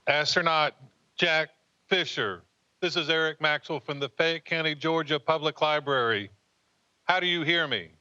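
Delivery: loud formal radio hail; calling out over a space radio link; sci fi communicator. Real, not acted.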